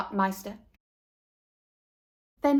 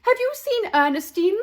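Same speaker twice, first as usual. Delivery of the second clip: anxious call; 10 semitones higher, 6 dB louder than usual. A narrator's reading. Parents searching for daughter.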